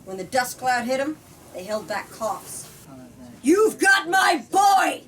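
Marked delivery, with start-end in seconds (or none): 3.4-5.0 slow, higher, booming yell into the night; loud drunken shout. Acted. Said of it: "Mister Graff was way too loud".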